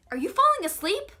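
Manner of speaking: high, loud mock-alarmed question; alarmed mom. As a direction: imitate a mother calling out in alarm. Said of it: "I heard my mom ask".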